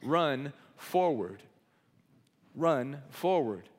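very slow, each word weighed; slow emphatic point. Shown as a demonstration. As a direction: lay down the sermon point slowly. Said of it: "The first point of my message".

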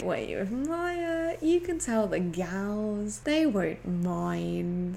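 very drawn-out mock reassurance; slow, hammed-up friendly nudge; slow coaxing. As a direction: stretch each phrase out, coaxing a friend. Acting out Hannah coaxing her friend.

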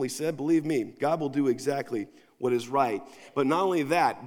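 usual speaking voice

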